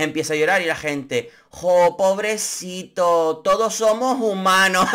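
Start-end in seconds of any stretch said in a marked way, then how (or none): 1.5-4.9 mocking pity. Mocking sympathetic viewers.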